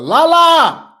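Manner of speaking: loud, high call across the house. Real, not acted. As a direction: shout her name to the next room. Calling wife from other room.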